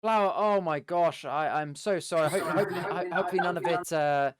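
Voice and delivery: large, theatrical apology. Acted. Adventurer fretting over the orc's spilled flour, theatrical. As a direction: make a big show of being sorry. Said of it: "I'm being very, very large about this".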